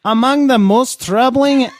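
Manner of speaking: high, loud Swedish-sounding lilt; middle accent. Acted. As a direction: put on a sing-song Midwest accent. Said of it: "why is that Swedish?".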